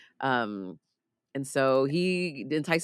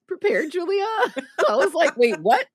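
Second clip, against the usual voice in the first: real laughter, high and loud; cracking up in disbelief; laughing; 6 dB louder than usual. Real, not acted. Podcaster laughing to Julia about the toe scene, laughing hard.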